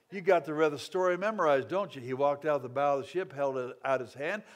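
ordinary talk

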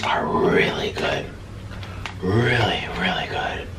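hushed savoring whisper. Real, not acted. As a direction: whisper it like a secret delight. Savoring chicken fingers.

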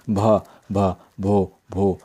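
a light mock stammer on भा and भो. Frightened girl stammering her name to teacher. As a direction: repeat each syllable, stuck and halting. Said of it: "She began to stammer".

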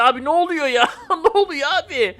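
real laugh in the question; laughing bewilderment. Real, not acted. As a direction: ask in amused disbelief. Laughing at zombie chaos.

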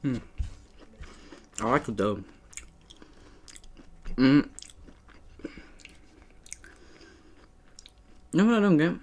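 chewing while talking; muffled eating speech; mouth full of cookie. Real, not acted. Seen 3: holds cookie, chews throughout, puts a piece in mouth mid-phrase.

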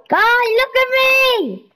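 high loud call; high calling plea. Real, not acted. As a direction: shout to get his attention. Calling the villager.

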